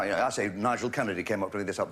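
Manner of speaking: ordinary delivery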